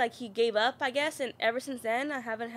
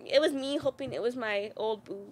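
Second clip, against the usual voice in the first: usual speaking voice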